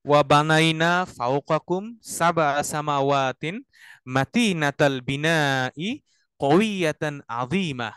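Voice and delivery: very slow, drawn-out chanted Arabic; slow recitation. Shown as a demonstration. Teacher chants the verse with its meaning to the class. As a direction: stretch each phrase like tarteel. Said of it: "মিনিংটা কি দাঁড়াচ্ছে".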